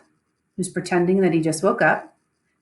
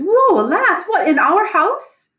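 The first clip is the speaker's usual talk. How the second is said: high, loud mock dismay; a lady hamming up her horror; feigned shock; 11 semitones higher, 6 dB louder than usual. Lady Macbeth faking shock at the murder news, high and loud. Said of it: "reacts accordingly".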